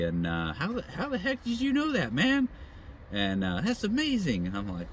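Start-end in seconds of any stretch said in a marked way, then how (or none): 0.5-2.5 amazed disbelief. Retold or imitated. Amazed stranger voiced in story. 3.6-4.5 amazed. Retold or imitated. Amazed stranger voiced in story.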